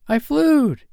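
very high, loud, thrilled cry; little boy's scream. A narrator's reading. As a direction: squeal it out like a small boy. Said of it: "he screamed while still in midair".

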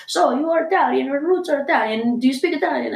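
high, eager interviewer voice; Italian TV host. Retold or imitated. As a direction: mimic the excited Italian host. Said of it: "and then they're like".

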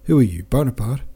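shouted challenge. A narrator's reading. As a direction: call out an indignant challenge, without full force. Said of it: "shouted Makar Alexeyevich".

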